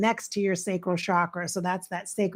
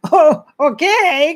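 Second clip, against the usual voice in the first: laughing okay; 8 semitones higher, 11 dB louder than usual. Real, not acted. Laughing reaction to spirit.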